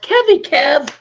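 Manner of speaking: high, loud happy hello; excited greeting. Real, not acted. Streamer greeting a regular viewer, loud and delighted. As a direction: call out the name with delight.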